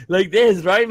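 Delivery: ordinary delivery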